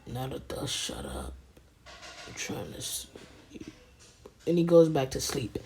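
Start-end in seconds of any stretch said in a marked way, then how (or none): 0.1-3.7 barely voiced, very soft and low; hushed, drowsy complaint; sleepy grumble. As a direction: whisper it as if trying to sleep. Sleeping Sasuke answers Naruto.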